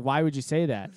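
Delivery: loud, baffled question; incredulous protest. Retold or imitated. Man baffled by his date's remark, loudly incredulous.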